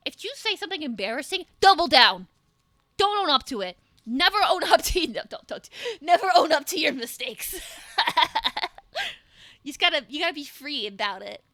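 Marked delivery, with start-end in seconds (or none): 1.6-7.6 loud, emphatic bad advice; mock rallying shout. Joking advice to chat, shouted with mock conviction. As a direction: declare it like a motto, loud.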